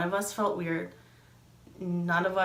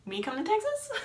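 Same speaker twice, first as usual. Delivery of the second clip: acted incredulity; 13 semitones higher than usual. Friend suggests she come along, high incredulous question.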